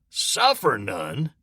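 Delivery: "Suffer none?" slowly, in a changed voice, rising; incredulous echo. Acted. Morris throws "Suffer none?" back at Lewis in disbelief. Another private echoes incredulously.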